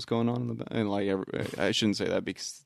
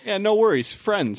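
much higher, louder, breezy mock voice; mock friendly reply; 11 semitones higher, 5 dB louder than usual. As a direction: brush it off in a high silly voice.

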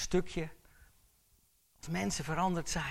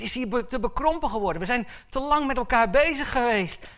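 preaching at full force; 8 semitones higher, 10 dB louder than usual. Acted. Preacher sending the church out, preaching at full force. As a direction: send them out with a ringing command.

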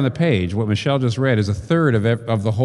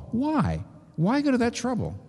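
high pleading question; 11 semitones higher, 5 dB quieter than usual. Real, not acted. Rhetorical question in a sermon.

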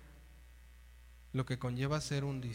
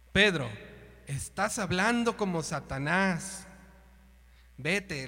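loud stern rebuke of Peter, slow on the middle line; 6 dB louder, slower than usual. Retold or imitated. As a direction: scold him like Jesus rebuking Satan. (reprensión fuerte y lenta de Jesús a Pedro)

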